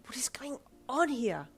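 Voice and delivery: breathy inner whisper. Acted. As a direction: whisper it to yourself. Screaming internally, inner thought.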